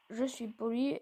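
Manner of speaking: ordinary talk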